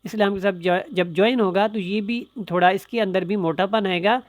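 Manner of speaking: ordinary delivery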